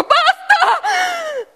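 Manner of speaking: un urlo acuto e forte; grido secco. Acted. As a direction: ferma tutto con un grido. Fine dell'attacco, grido. (grido alto e potente)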